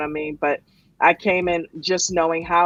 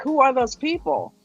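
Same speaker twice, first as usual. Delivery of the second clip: higher-pitched baffled question; retold bewilderment; 6 semitones higher than usual. Recalling strangers talking with coach, retold bewilderment. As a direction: ask it as if truly puzzled. Retelling puzzled reaction to coach's stream.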